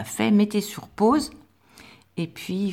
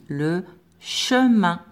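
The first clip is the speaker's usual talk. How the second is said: le chemin drawn out slowly, each syllable clear; slow dictation; much slower than usual. Shown as a demonstration. Teacher dictating a word to pupils, very slow. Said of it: "on va écrire un mot de deux syllabes".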